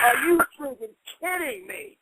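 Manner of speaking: can't believe what he heard; incredulous. Retold or imitated.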